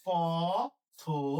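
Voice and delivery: পথবাসী drawn out very slowly; slow dictation. Shown as a demonstration. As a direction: stretch পথবাসী while writing it. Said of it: "কি লিখবো?".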